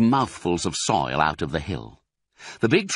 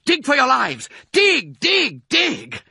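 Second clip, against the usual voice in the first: very high, loud frantic command; panicked high-pitched yelling; urgent shout; 4 dB louder than usual. A narrator's reading. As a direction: shout for them to save their lives. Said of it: "shouted Mister Fox".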